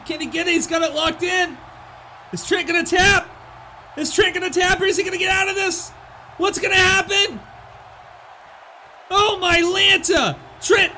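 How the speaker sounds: high, loud call of a near-tap; rising hype, shout it; excited shout. Real, not acted. Submission near-finish in commentary.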